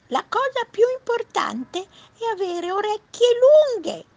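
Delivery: very high little rabbit voice. Acted. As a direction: squeak it out as an earnest little rabbit. Rabbit voice in read story. (vocina acuta da coniglio)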